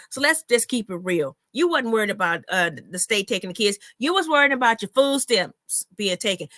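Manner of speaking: ordinary talk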